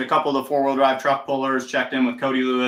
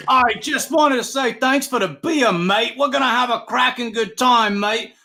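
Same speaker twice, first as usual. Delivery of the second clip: put-on high, Aussie-sounding South African accent, mate drawn up; cheerful South African thanks, pitched up and Aussie-ish; 10 semitones higher, 3 dB louder than usual. South African fan thanks announcer for the beer in a high Aussie-ish accent. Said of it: "sound like an Australian".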